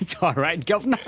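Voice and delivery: jokey fake Cockney, lifting at the end; Australian accent gone Cockney, amused; mock Cockney. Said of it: "my Australian accent just turns Cockney".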